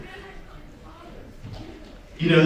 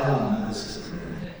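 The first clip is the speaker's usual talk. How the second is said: whispering; 7 semitones lower, 8 dB quieter than usual. Retold or imitated. Nervous aside while waiting.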